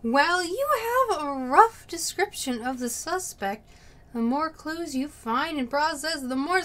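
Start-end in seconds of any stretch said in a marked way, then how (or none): none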